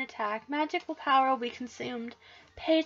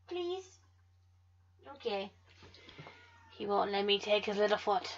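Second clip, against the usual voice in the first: ordinary talk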